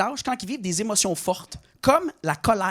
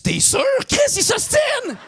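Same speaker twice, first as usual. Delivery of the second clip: high, slow shouted call to a friend; the fan calls his buddy, outraged; indignant fan; 3 dB louder, slower than usual. Retold or imitated. Fan shouting to his friend that the comedian is arguing, high slow shout. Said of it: "Il me dit".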